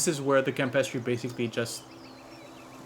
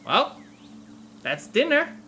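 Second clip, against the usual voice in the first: goblin voice; 11 semitones higher, 4 dB louder, slower than usual. Acted. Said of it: "we can hear the goblin goes".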